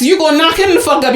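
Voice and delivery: ordinary delivery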